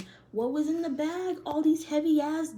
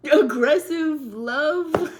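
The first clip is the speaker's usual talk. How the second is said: laughing; 3 semitones higher, 9 dB louder, slower than usual. Real, not acted. Amused question.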